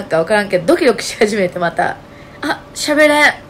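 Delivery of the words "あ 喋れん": a small gasp of panic, a touch of a catch in the voice; reliving the moment she can't speak; mock panicked gasp. Retelling her panic attack at the restaurant, catching breath.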